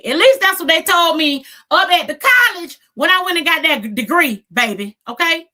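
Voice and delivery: loud, high, emphatic comeback; sassy proclamation. Real, not acted. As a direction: proclaim your credentials with full sass. Sassy boast of her credentials.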